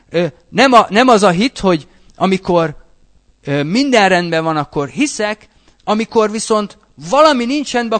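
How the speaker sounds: ordinary talk, nothing marked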